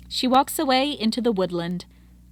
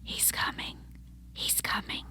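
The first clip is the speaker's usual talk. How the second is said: eerie hushed whisper, much softer; Parseltongue whisper; 11 dB quieter than usual. Acted. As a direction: breathe it like a snake's warning. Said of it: "some words spoken in Parseltongue".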